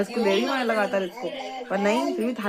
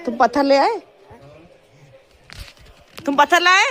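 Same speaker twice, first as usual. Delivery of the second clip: high, loud teasing questions; loud high playful questions; playful pretend call; 8 semitones higher, 10 dB louder than usual. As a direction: play along with the toddler's phone game. Playful pretend phone chat.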